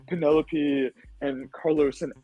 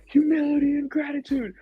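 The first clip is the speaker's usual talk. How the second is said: high, hammed-up chant of a shouting teammate; mock T J yell. Mocking T J yelling after crushing our team. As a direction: parody his victory cry. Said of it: "when T J is yelling, he goes".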